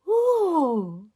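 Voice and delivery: high, loud ooh; drawn-out impressed ooh; wondering cry. A narrator's reading. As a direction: coo like amazed children. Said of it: "they cried".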